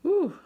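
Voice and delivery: loud, high whew at a strong smell. Real, not acted. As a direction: blow out a big whew.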